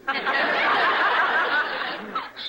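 a high, loud burst of laughter; ecstatic laugh. Acted. Young poet laughing at the rival husband, giddy laugh.

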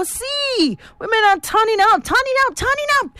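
high, excited turning up; reading a fan text on air with rising excitement; hyped reading. Acted. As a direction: hype the crowd as you read. Reading listener message excitedly.

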